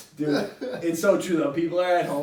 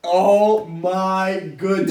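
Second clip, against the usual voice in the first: oh my goodness; 4 semitones higher, 9 dB louder, much slower than usual. Real, not acted. Shocked reaction to HR email.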